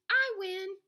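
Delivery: high, loud little boast; triumphant. A narrator's reading. Littlest rabbit claiming the cuddliest prize, triumphant. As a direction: crow it like the winner.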